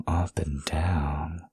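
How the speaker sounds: soft slow whisper. Acted. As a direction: whisper the instruction gently. Intimate instruction to partner.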